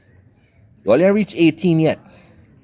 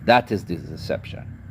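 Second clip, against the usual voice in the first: hushed repeat; 8 semitones lower, 7 dB quieter than usual. Real, not acted. Ranting to viewers, repeating the point in a low hush. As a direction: let "That is the deception" sink into a grave near-whisper.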